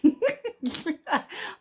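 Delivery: high-pitched giggle. Real, not acted. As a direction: giggle at herself. Laughs at air kick.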